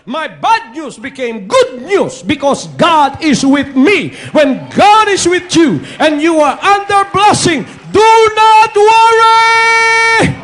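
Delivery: loud, rising shouted praise; preaching at full force. Real, not acted. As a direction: drive it home to the crowd. (malakas na pangangaral, sumisigaw)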